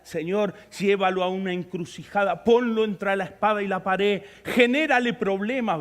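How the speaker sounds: ordinary talk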